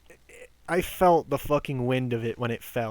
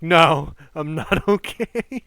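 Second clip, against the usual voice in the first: laughing through the retold answer; can't finish the line without laughing; 4 semitones higher, 8 dB louder, slower than usual. Retelling the answer to the coworker, laughing. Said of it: "And I'm like".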